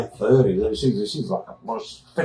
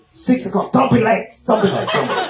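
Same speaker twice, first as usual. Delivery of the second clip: mock Austrian insistence; 9 semitones higher than usual. Babsy insisting, high voice.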